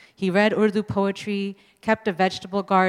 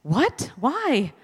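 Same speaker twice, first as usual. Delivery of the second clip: surprised outburst; 5 semitones higher than usual. Retold or imitated. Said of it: "Taslima and I burst out".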